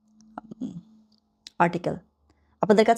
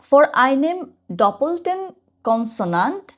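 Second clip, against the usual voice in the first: slow re-reading; 5 semitones higher, much slower than usual. Shown as a demonstration. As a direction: reread the phrase very slowly with a rising end. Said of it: "ওখানে কি লেখা?". (আবার ধীরে ধীরে পড়া)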